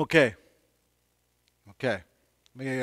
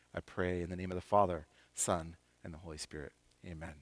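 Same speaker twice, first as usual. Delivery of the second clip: prayerful blessing; 5 semitones lower, slower than usual. Acted. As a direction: pray the blessing over them gently.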